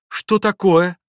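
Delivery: high, loud, anxious question; startled, worried shout; alarmed cry. A narrator's reading. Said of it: "закричала Лис уже тревожным голосом".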